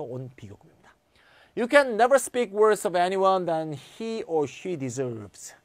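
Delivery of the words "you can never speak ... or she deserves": slow, deliberate English read-aloud; example sentence reading. Shown as a demonstration. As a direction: read it out word by word for the class. (천천히 또박또박 영어 예문 낭독)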